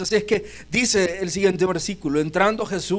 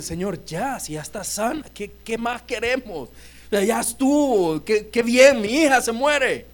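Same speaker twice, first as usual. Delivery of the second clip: pleading father; 6 semitones higher than usual. Acted. Jairo pleading beside Jesus.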